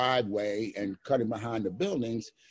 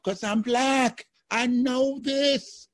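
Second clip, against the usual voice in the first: pitched way up and slowed right down, mock-indignant; high drawn-out mock outrage; high, slow punchline; 12 semitones higher, 3 dB louder, much slower than usual. Punchline about being locked up.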